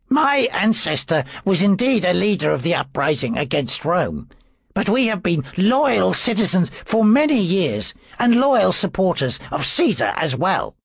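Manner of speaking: rising, defensive priest; earnest priest voice. A narrator's reading. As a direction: protest your loyalty. Priest defends his family.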